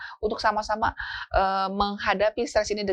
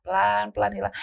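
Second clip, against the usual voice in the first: ordinary talk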